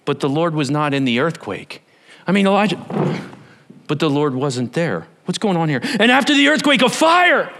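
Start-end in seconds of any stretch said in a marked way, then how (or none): 6.0-7.5 high, loud preacher's cry; rising, full-force scripture reading; dramatic announcement. A narrator's reading. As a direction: cry out the arrival of fire.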